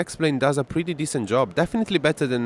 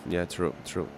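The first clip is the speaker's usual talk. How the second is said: normal talking voice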